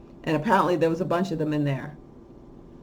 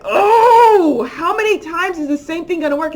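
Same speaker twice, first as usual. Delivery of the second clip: exasperated wail; 9 semitones higher, 7 dB louder than usual. Real, not acted. Exasperated rant about repeated panic.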